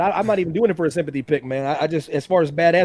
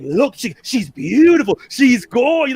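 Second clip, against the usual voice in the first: awed; 6 semitones higher, slower than usual. Retold or imitated. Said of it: "he's like".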